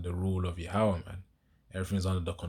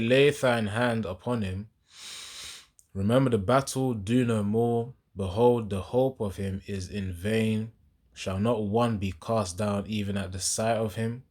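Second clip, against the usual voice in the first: scripture reading; 3 semitones higher, 3 dB louder than usual. Acted. Preacher reads a verse to listeners, emphatic scripture reading. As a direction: read it out forcefully, drawing out the phrase ends.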